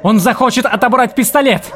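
high, loud hysterical shriek. Acted. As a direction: shriek with a crazed edge. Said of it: "завопила Джессика".

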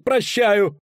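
high-pitched wailing cry; panicked howl. A narrator's reading. Poet forgiving the executioner under threat, howling. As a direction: wail it out high. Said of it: "взвыл Лютик".

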